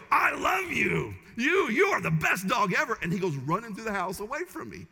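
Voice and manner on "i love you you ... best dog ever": sweet doting voice. Shown as a demonstration. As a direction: gush over the dog in a doting pet voice. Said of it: "look at the dog and go".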